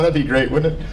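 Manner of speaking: ordinary talk, nothing marked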